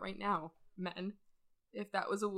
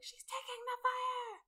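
mock soldier shout. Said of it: "And they're like".

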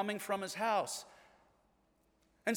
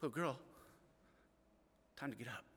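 much softer, tender and low; gentle wake-up; 5 semitones lower, 12 dB quieter, faster than usual. Retold or imitated. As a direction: coax a sleeping child awake. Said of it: "But to a little girl".

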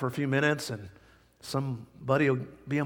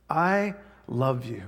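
tender; 4 semitones lower, slower than usual. Acted. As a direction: let it land gently, like a father. Tender soft close of refrain.

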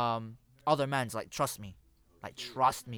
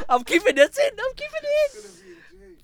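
laughing; 13 dB louder than usual. Real, not acted. Laughing fit after live slip.

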